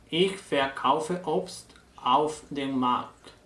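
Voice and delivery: very slow, careful German sentence for learners; slow German example. Shown as a demonstration. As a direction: read it out slowly and clearly in German. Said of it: "ese nuevo verbo va a significar vender". (frase alemana muy lenta y clara)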